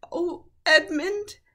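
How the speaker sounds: put-on high, slow tearful girl; on the edge of tears. A narrator's reading. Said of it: "began to cry".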